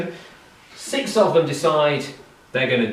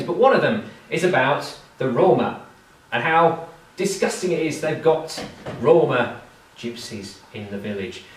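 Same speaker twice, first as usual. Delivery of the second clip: ordinary delivery, nothing marked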